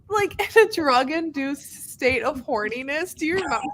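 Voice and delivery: giggling through the words; amused laughing recall. Real, not acted. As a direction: laugh while telling it. Laughing while recalling scene.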